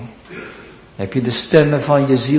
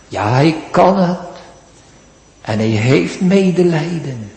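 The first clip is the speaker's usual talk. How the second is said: ordinary talk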